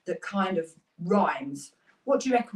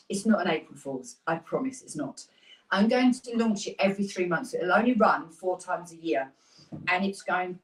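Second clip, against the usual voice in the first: ordinary talk, nothing marked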